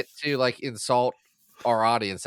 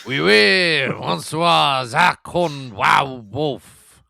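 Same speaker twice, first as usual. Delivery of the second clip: hammed-up French accent, louder and slow on the name; bad French accent; 3 semitones higher, 8 dB louder, much slower than usual. Cohost greets his partner in a hammy fake French accent. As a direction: butcher a French accent on purpose, drawing out the name. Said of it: "these bad attempts at French accents".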